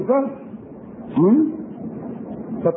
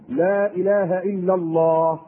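slowed for emphasis; 4 semitones lower, much slower than usual. Acted. Preacher dwelling on the creed.

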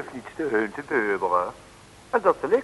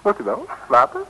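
much higher teasing question; playful high aside; 9 semitones higher, 6 dB louder than usual. Acted. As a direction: tease the listeners.

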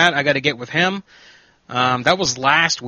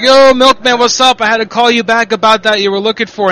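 retold loud greeting; 6 semitones higher, 6 dB louder than usual. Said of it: "I said, I'm like".

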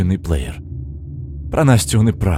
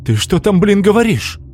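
sharp, raised, accusing; angry outburst; 11 semitones higher, 3 dB louder than usual. A narrator's reading. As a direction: snap at him, high and loud. Igor accuses Slava. (возмущённый окрик)

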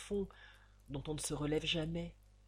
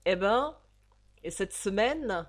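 put-on louder, hoarse old landlady; 6 dB louder than usual. The landlady demanding the week's rent, hoarse and loud. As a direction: rasp it out like a boozy old hag. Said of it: "de sa voix enrouée de sirop cognac".

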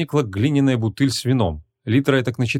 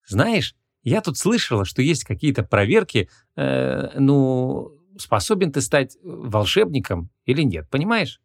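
higher, halting, hesitant request; timid young woman; 3 semitones higher, slower than usual. A narrator's reading. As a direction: feel your way shyly toward a favor. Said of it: "робко начала она". (робко, с запинками, женским голосом)

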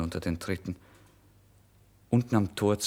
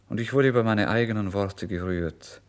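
plain reading voice

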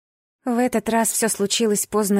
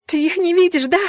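high, loud, distraught cry; straining shout; 8 semitones higher, 5 dB louder than usual. A narrator's reading. Heroine held by guard, shouting desperately. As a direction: scream the question at him. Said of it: "продолжала надрываться я".

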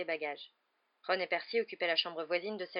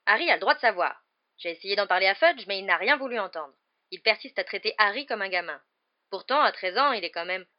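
loud, insistent protest; arguing father; 11 dB louder than usual. A narrator's reading. Husband arguing Harry's right to know, insistent. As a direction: press the point forcefully.

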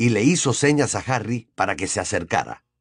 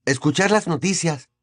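higher-voiced boy, resigned; 7 semitones higher than usual. A narrator's reading. Nephew explaining himself to his aunt and uncle, resigned. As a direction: give in with a resigned answer, pitched up. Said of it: "con tono resignado".

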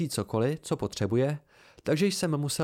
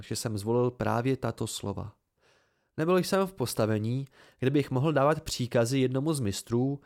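ordinary delivery, nothing marked

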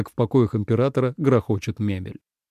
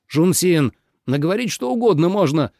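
raised-pitch protest; exclaiming; 6 semitones higher than usual. A narrator's reading. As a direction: exclaim it, dismissing the claim. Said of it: "воскликнул Минлу". (восклицая, с возражением)

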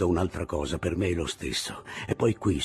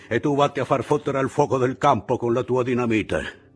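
mocking sentry; 5 semitones higher, 6 dB louder than usual. Sentry figure voiced.

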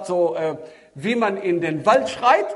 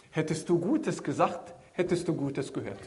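gentle motherly answer; 4 semitones lower, 8 dB quieter than usual. Pretend. Mother gently answers her son.

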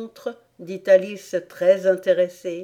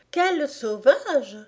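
higher, slow exclamation; exclaimed; 6 semitones higher, slower than usual. A narrator's reading. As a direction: cry out, stretching the words. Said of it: "s'écria Alice". (en s'écriant)

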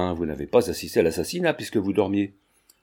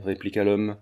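ordinary delivery

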